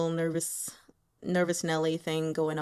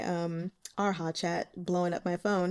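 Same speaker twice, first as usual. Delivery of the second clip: normal talking voice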